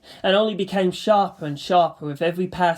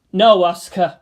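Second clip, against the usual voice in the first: firm; 8 dB louder than usual. Acted. Firm refusal.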